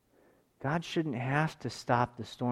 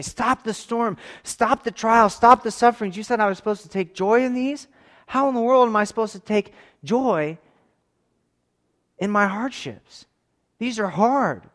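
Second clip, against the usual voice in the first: high, loud anguished cry to God; desperate scream; 8 semitones higher, 9 dB louder than usual. Pretend. Believers crying out to God in trial, loud desperate plea. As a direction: cry out as if at the end of your rope. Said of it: "we can scream at the top of our lungs".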